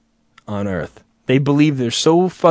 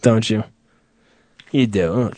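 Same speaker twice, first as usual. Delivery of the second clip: normal talking voice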